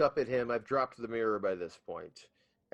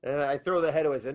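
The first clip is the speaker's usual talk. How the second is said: ordinary delivery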